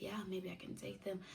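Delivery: whispered hopeful thought. Retold or imitated. Singing in bath, whispering she can cope. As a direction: breathe the words, unvoiced.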